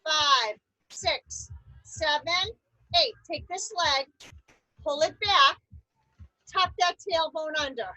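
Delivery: ordinary delivery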